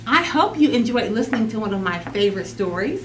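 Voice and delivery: usual speaking voice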